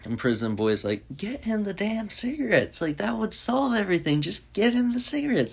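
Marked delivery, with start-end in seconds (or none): 1.2-5.5 much higher, a mock yell; exasperated demand. Prison Boy to the nurse, high exasperated demand. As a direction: plead in frustration with a high, strained voice.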